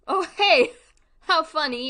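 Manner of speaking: sudden loud, high exclamation; surprised. Real, not acted. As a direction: caught off guard and amused. Finds her own stream in search.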